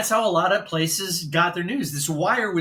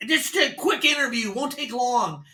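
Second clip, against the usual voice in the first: much higher, upbeat radio patter; disc jockey voice; 6 semitones higher than usual. Retold or imitated. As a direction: sell it like a morning zoo DJ. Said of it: "disc jockey sounding voice".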